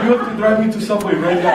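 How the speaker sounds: high, loud, laughing mock outrage. Friend complains to roommate about his eaten sandwich, hammed-up outrage. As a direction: squeal it like a wronged hungry friend. Hungry friend demanding a ride.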